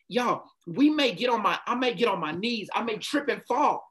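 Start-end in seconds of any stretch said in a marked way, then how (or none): none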